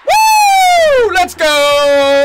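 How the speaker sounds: loud, high celebratory yell; excited shouting. Real, not acted. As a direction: scream it out in triumph. Celebrating a squad wipe.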